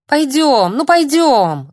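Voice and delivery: high, loud whiny pleading; whining. A narrator's reading. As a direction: whine like a pestering boy. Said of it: "заныл парнишка".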